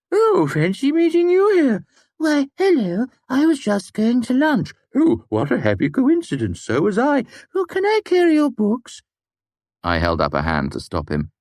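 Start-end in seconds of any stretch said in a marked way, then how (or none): 0.1-9.0 very high, loud squeaky chit-chat; puppet-show voices. Acted. Simon acts out flirting with his two hands, puppet-show voices. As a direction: play both puppets in exaggerated falsetto. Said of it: "making them talk to each other".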